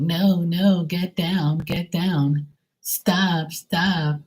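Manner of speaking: high, shrill mimicry of a woman scolding a dog; mock the annoying neighbor yelling at her dog; screechy neighbor calling dog. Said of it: "screechy voice calling her dog".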